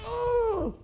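mock squeaky yelp of pain; high-pitched whimper. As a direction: squeal it like a tough guy near tears. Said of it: "he's getting high pitch".

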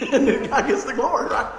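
real amused laughter breaking in; laughing. Real, not acted. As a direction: talk while laughing at yourself. Laughing at his preaching mishaps.

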